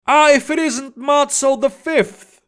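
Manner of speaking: high mock delight, a smug greeting; exclaimed. A narrator's reading. Kalabasi gloating as he greets the captured orc. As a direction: greet him with gloating surprise. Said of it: "Kalabasi exclaimed".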